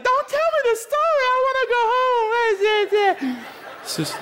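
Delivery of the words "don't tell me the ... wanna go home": much higher, whiny scared voice; high whiny mock fear; scared listener. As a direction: play a frightened congregant begging to leave. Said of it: "some of you are freaked out".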